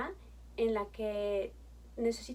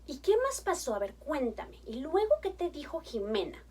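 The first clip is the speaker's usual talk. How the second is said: enthusiastic praise; 2 semitones higher than usual. Shown as a demonstration. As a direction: cheer her answer like it's wonderful. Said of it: "¿Sí, sí se fija mi tono de voz?".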